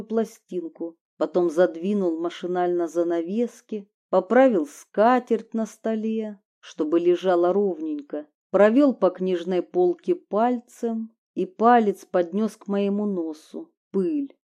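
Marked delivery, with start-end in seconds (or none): none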